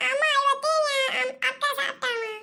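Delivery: put-on high, whiny child voice, tearful at the end; whining little brother. Little brother tells mother on his sister, high tearful whine. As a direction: wail a child's complaint to mum.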